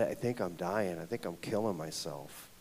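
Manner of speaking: low, soft retold admission; hushed, heavy confiding; subdued confession. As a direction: replay a quiet plea for help. Confessing to a trusted friend.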